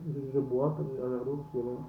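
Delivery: talking around a sip of water; speaking between sips of water; while sipping a drink. Real, not acted.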